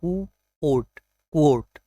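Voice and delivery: क्वोट broken as "koo-ote", then joined; correct pronunciation, demonstrated. Shown as a demonstration. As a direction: draw out the w in क्वोट. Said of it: "जो right है".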